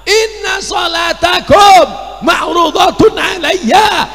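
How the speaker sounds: high, loud Arabic bellow; angry shouted recitation. Shown as a demonstration. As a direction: shout the sacred line as if scolding. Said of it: "solawat marah-marah".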